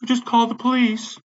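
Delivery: high, drawn-out mock brush-off; mocking imitation. Mocking managers who only say to call police, high mimicry. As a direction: mimic them in a slow, high voice.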